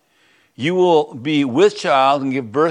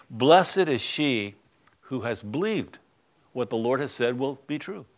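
hushed reading; 2 semitones lower, 7 dB quieter than usual. Acted. Reading Elizabeth's blessing softly.

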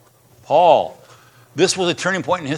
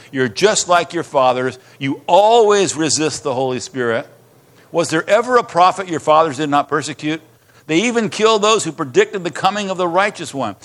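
preaching scripture; 2 semitones higher, 3 dB louder than usual. Acted. Pastor reads Stephen's rebuke to the council, forceful.

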